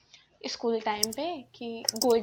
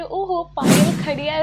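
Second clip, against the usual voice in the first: mock teasing; 4 semitones higher, 10 dB louder, slower than usual. Classmates teasing her in class.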